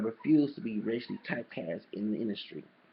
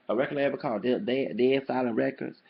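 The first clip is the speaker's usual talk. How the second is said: ordinary delivery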